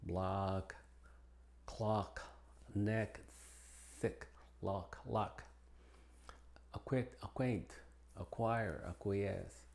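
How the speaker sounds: only the K sound is heard; English silent C. Shown as a demonstration. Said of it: "como estas palabras que vemos aquí". (C muda antes de K y Q)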